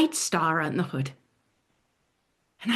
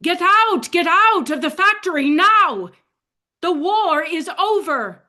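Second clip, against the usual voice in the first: much higher and louder shout; yelling liberators; 10 semitones higher, 6 dB louder than usual. Retold or imitated. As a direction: call out the good news at full voice. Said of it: "they're yelling".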